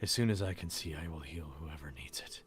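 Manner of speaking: soft, breathy whisper, fighting for calm; strained whisper. Acted. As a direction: whisper it, trying to center himself. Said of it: "breathing heavily".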